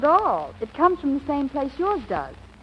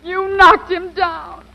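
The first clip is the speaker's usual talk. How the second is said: distressed wail; 7 semitones higher than usual. Acted. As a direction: wail over the injured man, fading at the end. Cries over the knocked-out man.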